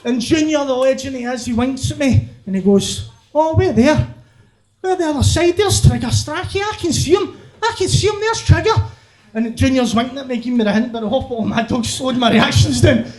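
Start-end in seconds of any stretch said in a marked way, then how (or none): 3.3-8.7 feigned discovery. Acted. Junior pretends to spot Trigger.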